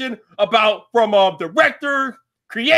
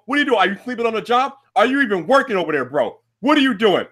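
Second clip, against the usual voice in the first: ordinary talk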